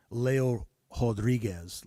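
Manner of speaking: "Leo Rodriguez" said slowly as LAY-oh hod-REE-gez; slow Brazilian-style "Leo Rodriguez", R as h; Brazilian pronunciation. Shown as a demonstration. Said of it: "so it looks like".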